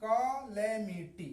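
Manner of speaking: slow कैलेमिटी, starting 'kuh', then 'LAE-mity'; correct pronunciation. Shown as a demonstration. Teacher drills the right reading, correct pronunciation. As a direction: separate the syllables of कैलेमिटी clearly. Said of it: "इसको पढ़ेंगे का".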